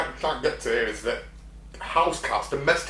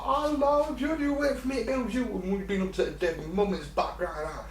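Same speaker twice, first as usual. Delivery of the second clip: put-on high cooing baby talk; imitating friend's baby talk; 8 semitones higher, 4 dB quieter than usual. Friend cooing to her cat, mocked high baby talk. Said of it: "she's like".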